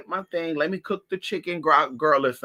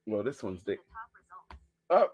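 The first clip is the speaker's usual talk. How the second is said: ordinary talk, nothing marked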